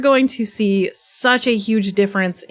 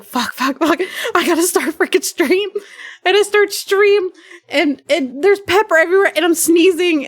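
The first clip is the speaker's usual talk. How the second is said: frantic panic; 8 semitones higher than usual. Retold or imitated. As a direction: blurt it out in a rising panic. Frantic rush before stream.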